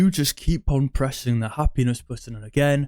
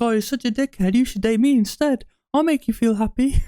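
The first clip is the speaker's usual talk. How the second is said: much higher, pleading mock nice-guy voice; 11 semitones higher than usual. Nice guy begging the girl to date him, high simpering mock. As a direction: squeak out the pitch to date her like a pushover. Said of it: "And you'll be like".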